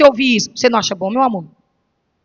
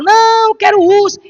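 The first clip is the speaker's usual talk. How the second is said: put-on whiny child; 7 semitones higher, 3 dB louder than usual. Child begging for the bear.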